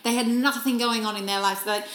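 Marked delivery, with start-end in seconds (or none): none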